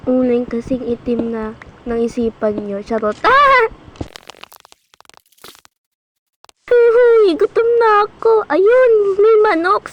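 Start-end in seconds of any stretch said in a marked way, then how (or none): 3.2-9.9 high, loud hungry outburst; spotting food at last, loud and high; excited shout. Real, not acted. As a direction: yell with sudden excitement. Suddenly starving, spots chicken.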